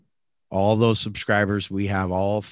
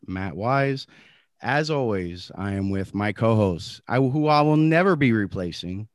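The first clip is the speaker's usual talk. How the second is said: ordinary talk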